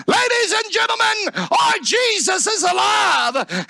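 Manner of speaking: high, ringing revival shout; full-force preaching. Real, not acted. Preacher tells congregation Jesus is alive, impassioned shout. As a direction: proclaim it at the top of your lungs.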